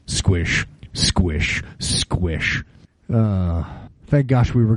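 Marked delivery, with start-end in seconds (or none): 0.1-2.6 low, slow squish, squish, squish; squishing boot imitation. Walking with one soaked foot, low squish sounds. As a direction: mimic the wet boot with each step.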